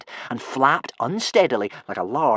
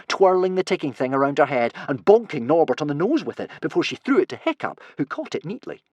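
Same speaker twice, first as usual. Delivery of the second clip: usual speaking voice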